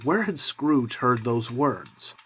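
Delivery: ordinary delivery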